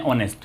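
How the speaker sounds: ऑनेस्ट read with no H sound; silent H. Shown as a demonstration. Teacher showing how it is read, ऑनेस्ट with silent H. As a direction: open ऑनेस्ट on the O vowel. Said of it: "लेकिन इसको हम read क्या करते हैं?".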